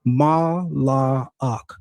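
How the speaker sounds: Mala'ak broken into slow syllables; sounded out. Shown as a demonstration. Debater sounds out the word in question, syllable by syllable. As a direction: say Mala'ak drawn out as Ma, la, ak. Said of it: "you could deal with this word".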